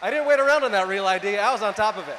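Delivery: loud proud punchline shout. Real, not acted. Comic bragging to crowd about getting real ID, loud shout. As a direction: yell it out like a brag.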